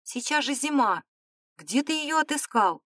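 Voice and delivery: astonished at an impossible gift; surprised. A narrator's reading. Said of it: "воскликнула она с удивлением".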